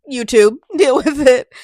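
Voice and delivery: cheeky loud defiance; defiant laughing shout. Real, not acted. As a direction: tell YouTube off with a laugh. Defiant laughing address to YouTube.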